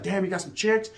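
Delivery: ordinary delivery, nothing marked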